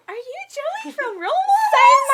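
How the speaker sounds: high, tearful fan squeal; excited teary shout. Retold or imitated. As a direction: shriek it like a starstruck fan about to cry. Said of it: "they almost cried".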